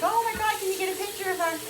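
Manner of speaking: high, fast excited squeal; excited friend. Retold or imitated. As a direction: gush it out, freaking out with excitement. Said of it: "she was freaking out".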